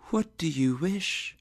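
high, airy ghostly whisper; whispering spirit. Acted. Spirit asks Scrooge about his regret, whispered.

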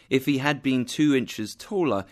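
plain reading voice

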